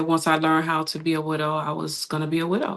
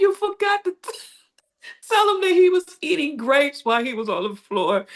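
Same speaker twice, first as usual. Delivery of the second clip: laughing quote; 9 semitones higher than usual. Retold or imitated. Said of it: "She walked up and said".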